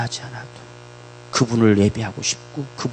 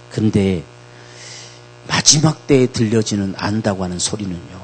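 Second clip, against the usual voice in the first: ordinary talk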